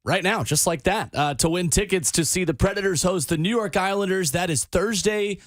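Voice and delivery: upbeat radio giveaway pitch; radio contest promo. Acted. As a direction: hype the ticket contest.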